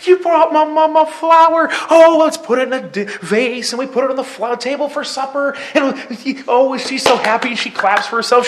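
put-on high, loud retelling of a child gone giddy; wildly excited. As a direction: gush like an overjoyed little girl. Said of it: "she went crazy".

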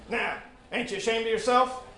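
scolding Mrs Jones; scolding woman. Acted. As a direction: shame the boy like a stern mother.

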